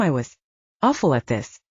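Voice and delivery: usual speaking voice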